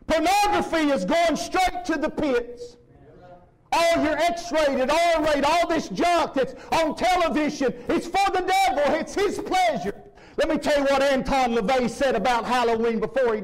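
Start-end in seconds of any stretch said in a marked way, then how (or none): 0.1-9.9 slow, emphatic preaching. Acted. Pastor warning the church about television, emphatic. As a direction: drive each word home.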